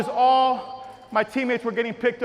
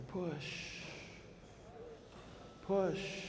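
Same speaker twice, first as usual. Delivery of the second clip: much softer hushed push; whisper; 4 semitones lower, 18 dB quieter than usual. Shown as a demonstration. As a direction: breathe the word out softly. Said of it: "Everyone whisper and say".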